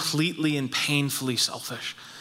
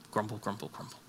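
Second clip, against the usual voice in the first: low, much softer grumble, grumble, grumble; sulky muttered grumbling; mock grumbling; 6 semitones lower, 12 dB quieter, faster than usual. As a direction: mutter it under the breath like a sulking churchgoer. Mocking grumble after complaint.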